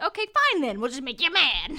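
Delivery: high, loud mock huff; exasperated retort. Zoe answering the dragon with a huffy high retort. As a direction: toss it off with comic defiance.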